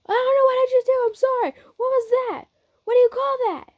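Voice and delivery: very high, loud, panicked confusion; flustered brash fighter. Acted.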